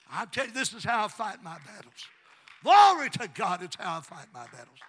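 loud declaration with a shouted Glory; full-force preaching. Real, not acted. Preacher praising God after the healing, shouting. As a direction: burst out in praise, then drive home the refrain.

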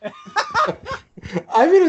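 high-pitched burst of laughter; cracking up while starting to reply; laughing. Real, not acted. Guest defends his verdict to outraged hosts, laughing hard.

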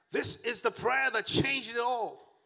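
high, ringing preacher's cry; impassioned preaching. Real, not acted. Preacher proclaims Mary's prayer to the congregation, impassioned preaching. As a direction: lift the pitch and proclaim it with full fervor.

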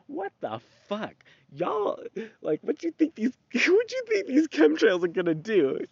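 high, mocking voice as if talking to the sprayers; mocking outraged rant. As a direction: squeal it out in a mock outrage, half laughing. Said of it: "I'm like".

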